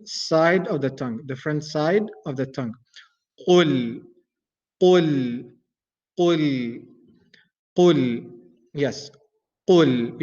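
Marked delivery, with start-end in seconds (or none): 3.5-10.2 qul with a heavy back q and a light l, for repeating; correct qul. Shown as a demonstration. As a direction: model qul slowly for the student. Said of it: "You can practice with me".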